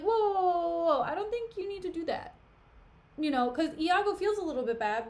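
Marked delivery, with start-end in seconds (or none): 0.0-2.3 high, loud panicked whoa to stop him; alarmed whoa. Retold or imitated. Iago stopping Othello from killing his wife, high alarmed. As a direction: rush in high and alarmed to hold him back.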